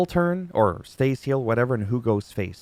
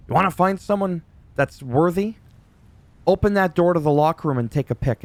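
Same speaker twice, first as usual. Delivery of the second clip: imitating angry Kevin Owens; 7 semitones higher than usual. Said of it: "He's really pissed".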